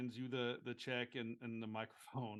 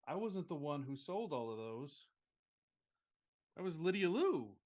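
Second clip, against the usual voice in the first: raised-pitch heartfelt announcement to a crowd; tearfully happy; 5 semitones higher, 6 dB louder than usual. Acted. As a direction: play an emotional winner crediting a friend. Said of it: "she's of course got tears in her eyes".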